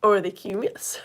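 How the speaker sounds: a light put-on Geordie accent. Teasing her Whitley Bay husband with a mild Geordie accent. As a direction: play at sounding like a local from Whitley Bay. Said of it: "He loves it when I do the accent".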